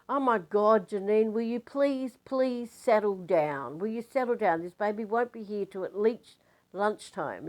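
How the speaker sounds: exasperated scolding. Retold or imitated. Exasperated nurse scolds labouring woman.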